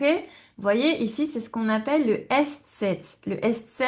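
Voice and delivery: usual speaking voice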